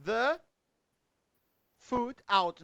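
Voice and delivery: isolated words with long gaps between; word-by-word example. Shown as a demonstration. Teacher showing a separated phrasal verb, slowly, word by word. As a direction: lay out the sentence piece by piece.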